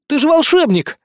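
put-on high, loud boyish cry; excited cry. A narrator's reading. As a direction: burst out in disbelief. Boy cries out.

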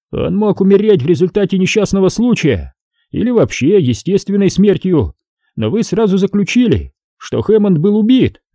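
high, needling cop voice; mocking detective, high voice; sly cop Ramos. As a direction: poke fun at the suspect, pitched high.

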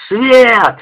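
high, loud howl for the light; howled order. Acted. Soldier howls to the whole company for light. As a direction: howl it across the barracks. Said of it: "взвыл Женька на всю роту".

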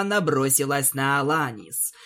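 plain reading voice

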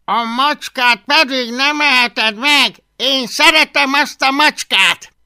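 loud, high, slow scolding; exasperated protest. Acted. Boy defends his cat from dragon, exasperated. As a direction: lay down the law loudly.

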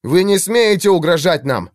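indignant outburst. A narrator's reading. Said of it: "встрепенулся Иллиан".

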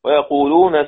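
usual speaking voice

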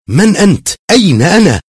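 put-on young man shouting at the unseen voice; angry loud question. A narrator's reading. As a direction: yell it out in rage and confusion. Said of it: "قال الشاب في غضب وبصوت جهور".

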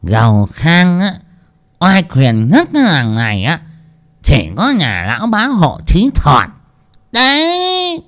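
high, slow slurred boast; drunken drawl. A narrator's reading. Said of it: "cất giọng lè nhè".

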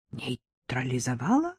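«Нейтрализовала?» low, soft and flat; «Нейтрализовала?» echoed in a dead, wooden voice; husband coldly repeats her word. A narrator's reading. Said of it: "деревянным тоном осведомился муж".

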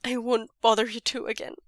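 crying out. A narrator's reading. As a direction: blurt it out in tears. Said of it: "Wei Ying cries".

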